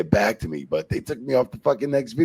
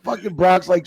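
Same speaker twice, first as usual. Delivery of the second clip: ordinary talk, nothing marked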